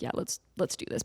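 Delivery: hushed nervous whisper, breath only. Retold or imitated. Whispering to the group before the dark tunnel. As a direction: whisper it like you're scared to be heard.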